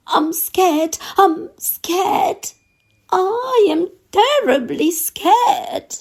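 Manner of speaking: high, frightened cook voice; scared cook. Acted. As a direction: squeak out the cook's fear, pitched high. Cook repeats his fear.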